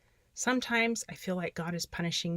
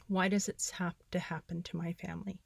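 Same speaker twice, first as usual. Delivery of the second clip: whispered; 6 dB quieter than usual. Real, not acted. Reading own despairing journal entry.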